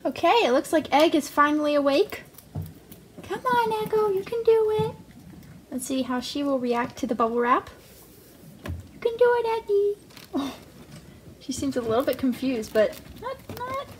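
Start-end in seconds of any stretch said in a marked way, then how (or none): 3.2-4.9 high cheering pet voice. Real, not acted. Owner cheering her rat on, high encouraging baby talk. 8.6-10.0 high cheering pet voice. Real, not acted. Owner cheering her rat on, high encouraging baby talk.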